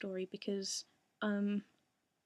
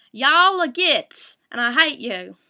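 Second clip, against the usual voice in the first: hammed-up Southern American accent, much higher and shouted, slow; bad Southern American accent; 5 semitones higher, 20 dB louder, slower than usual. Asked by fans for an accent, shouts in mock Southern American accent. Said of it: "do a Southern, uh, American accent".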